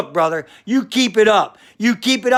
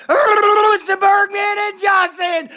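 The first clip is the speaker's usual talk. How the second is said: big congratulations; 11 semitones higher than usual. Acted. Bergman and Josh congratulating the podcast, shouting. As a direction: announce the congrats like a ring call.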